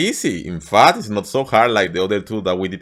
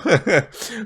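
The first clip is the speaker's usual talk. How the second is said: short laugh; 5 semitones higher than usual. Real, not acted. Laughs at own slip.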